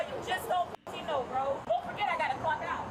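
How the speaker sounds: ordinary talk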